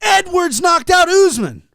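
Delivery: very high, drawn-out excited holler; retold ecstatic yell. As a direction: imitate a friend screaming big news. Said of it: "And he goes".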